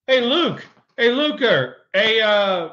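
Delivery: loud, high call to get attention. Real, not acted. Calling out to Luke to ask a question, loud and high. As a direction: hail a friend across the room.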